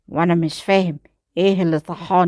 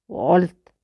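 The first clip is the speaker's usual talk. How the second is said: ordinary delivery, nothing marked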